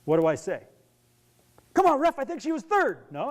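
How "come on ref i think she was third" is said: high pleading complaint to the ref; a parent arguing a result, pitched up; mock parent protest. Protesting the ref's call.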